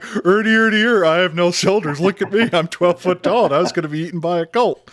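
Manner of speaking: loud, high, goofy creature voice; hammed-up monster voice. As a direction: squawk it out like a silly giant monster. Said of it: "I hope you're not like".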